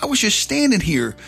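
indignant inner thought. Retold or imitated.

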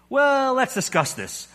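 high, flustered ghost voice; the anxious ghost, voice jumping up; nervous ghost. A narrator's reading. Ghost stalls.

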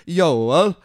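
"Yoel" with a Y sound opening it; Swedish pronunciation. Shown as a demonstration. Reader giving Joel the Swedish way, "Yoel" with a Y. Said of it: "that was Swedish for Joel".

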